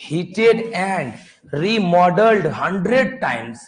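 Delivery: reading out very slowly. Shown as a demonstration. Teacher reading the line from the book, amazed. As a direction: drag the words out as you read. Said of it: "देखो ना क्या लिखा है".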